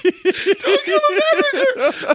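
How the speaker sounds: laughing fit. Real, not acted. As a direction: squeeze out the phrase mid-laugh. Laughing hard at own joke.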